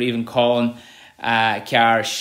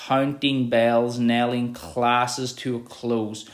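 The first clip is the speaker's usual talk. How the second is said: very slow, stretched vowels; slow, lingering reread; slow drawn-out reading; slower than usual. Shown as a demonstration. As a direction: let the O sounds ring long. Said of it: "The O's there really, um, create that long sentence".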